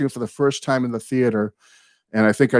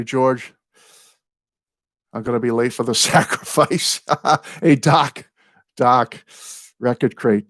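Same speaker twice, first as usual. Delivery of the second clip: ordinary talk, nothing marked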